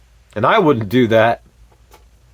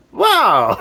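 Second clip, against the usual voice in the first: laughing wow. Real, not acted. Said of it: "I'm actually".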